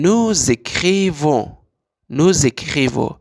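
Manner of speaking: slow, loud teacher model "nou-zécrivons"; liaison demo. Shown as a demonstration.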